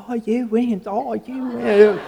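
put-on high Australian-accented robotic assistant voice; Australian Siri lady. Siri in the truck reading Dave's text, Australian accent. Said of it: "there's this Australian lady going".

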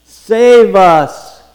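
forceful, rising acclamation; crying out. Acted. Preacher calls out the crowd's cry.

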